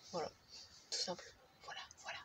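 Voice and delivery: soft unvoiced whisper. Real, not acted. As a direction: drop to a breathy whisper. Trailing off to a whisper.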